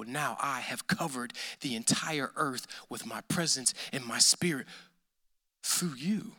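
tender hushed promise; hushed, near whisper. Real, not acted. Sermon, hushed climax.

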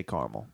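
"caramel" said as CAR-mul; two-syllable caramel. Shown as a demonstration. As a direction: say "caramel" without its middle vowel, CAR-mul. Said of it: "But I say caramel".

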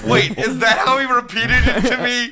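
high, loud through laughter; laughing in disbelief. Real, not acted. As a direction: ask it while cracking up. Cracking up at the repeat.

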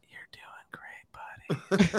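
slow hushed whisper; breathy slow affirmation whisper. Retold or imitated. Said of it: "words of affirmation".

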